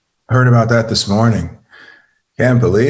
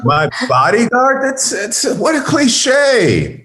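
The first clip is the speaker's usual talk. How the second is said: rising ever higher, slow scandalised finish; outraged disbelief; 11 semitones higher than usual. Acted. Husband learning of the bodyguard, high, slow outrage. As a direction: sputter in offended shock.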